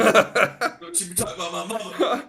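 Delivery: mock offended. Mock-offended joke about mama.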